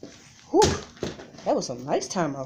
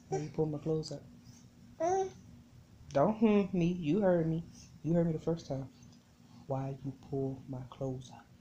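hushed scolding; 10 dB quieter than usual. Real, not acted.

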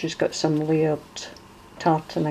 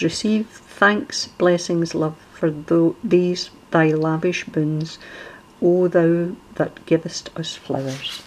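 reading a poem; 4 dB louder, slower than usual. Acted. Reads a verse from the envelope.

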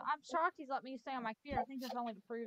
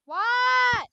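high, loud What? called across the house; loud shout; 12 semitones higher, 20 dB louder than usual. Real, not acted. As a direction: yell it to someone in another room. Shouts at sister off-mic.

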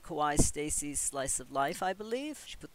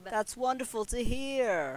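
lightly voiced happy Sim; 7 semitones higher, slower than usual. Sim replying to good news.

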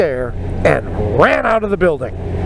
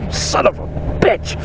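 higher-pitched wincing mutter through amusement; retold wincing curse; 12 semitones higher than usual. Graduate recognising his heckling friend, wincing retold curse. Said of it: "I'm wincing like".

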